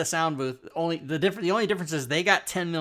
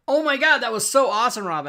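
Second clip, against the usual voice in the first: much higher and louder, gushing; excited praise; 9 semitones higher, 8 dB louder than usual. Retold or imitated. Players praising the game master's voice, high and loud excited. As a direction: cheer like amazed players. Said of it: "everybody was like".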